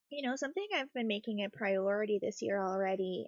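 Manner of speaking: normal talking voice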